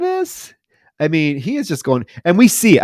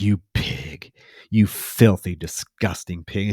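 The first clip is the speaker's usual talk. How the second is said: low, soft, drawn-out insult; mock disgusted contempt; 6 semitones lower, 7 dB quieter, slower than usual. Dentist insulting his assistant, mock contemptuous. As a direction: imitate quiet loathing, dropping low and slowing down.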